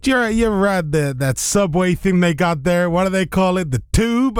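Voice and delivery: high-pitched curious American; American old boy. Retold or imitated. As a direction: imitate an American puzzling over British words. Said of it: "He asked me, he goes".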